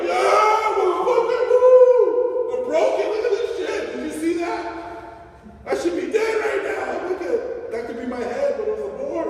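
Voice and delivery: high, loud whooping surfer voice; excited surfer. Acted. Surfer showing off the broken board, wildly excited. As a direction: whoop it up like a surfer who survived a wipeout. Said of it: "they're excited that they didn't get bit".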